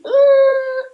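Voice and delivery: high, held groan of irritation; frustrated groan. Acted. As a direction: let out a mock exasperated noise. Noise standing in for irritation.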